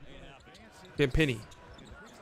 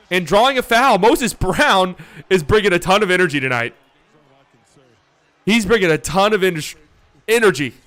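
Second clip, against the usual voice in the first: hyped call; 3 semitones higher, 4 dB louder than usual. Real, not acted. Hyping a hustle play.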